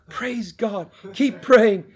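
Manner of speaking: high, loud cry of praise; exultant exclamation. Retold or imitated. Preacher relating news of lung movement, exultant shout. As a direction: call it out, building to a shout.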